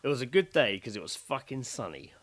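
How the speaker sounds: ordinary talk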